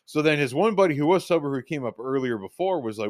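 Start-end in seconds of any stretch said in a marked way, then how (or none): none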